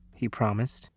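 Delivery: ordinary delivery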